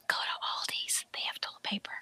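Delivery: hushed secret tip from a stranger; whisper. Retold or imitated. Shopper tipping off someone in Walmart, whispered. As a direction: pass it on in a whisper. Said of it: "came up and whispered to me".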